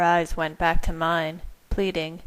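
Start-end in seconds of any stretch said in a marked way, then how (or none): none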